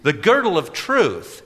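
put-on eager young man voice. A narrator's reading. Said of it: "he read".

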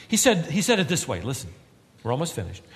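ordinary talk, nothing marked

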